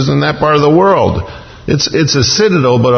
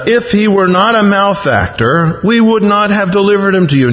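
higher-pitched, self-justifying reply; raised pitch, insistent justification; defensive answer; 7 semitones higher than usual. Acted. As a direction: answer back as if put on the spot. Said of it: "By the tone of their answer".

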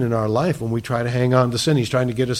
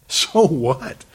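firm, full-voiced rhetorical challenge; dismissive, emphatic. Preacher challenging fear of seeming weird, firmly dismissive. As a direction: throw the question out with more force. Repeats it with more force.

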